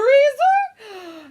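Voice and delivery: theatrical gasp; dramatic gasp. Acted. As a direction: gasp in hammed-up horror. Narrator imagines grim freezer.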